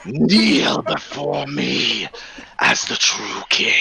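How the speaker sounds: very slow, low kingly command; slow regal command. Acted. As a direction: demand it like a self-crowned king. Player voices character's demand.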